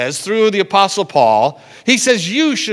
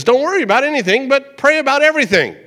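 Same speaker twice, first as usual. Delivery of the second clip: higher and fast, energetic reading; higher fast upbeat scripture read; lively quote; 7 semitones higher, faster than usual. Acted. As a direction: read it quick and bright. Reading alternate translation.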